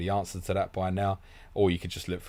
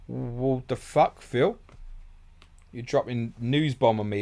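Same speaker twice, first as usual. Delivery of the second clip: shocked; 5 semitones higher, 4 dB louder, slower than usual. Real, not acted. Shocked by news question.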